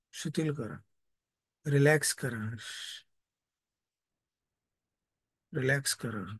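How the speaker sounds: calm meditation guidance; guided meditation. Acted. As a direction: lead a body relaxation gently. Leads a meditation.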